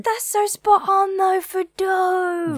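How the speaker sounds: high, excited and slow. Real, not acted. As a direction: gush with delight. Excited reaction.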